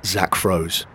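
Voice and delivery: usual speaking voice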